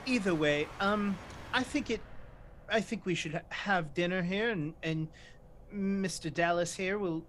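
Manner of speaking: ordinary talk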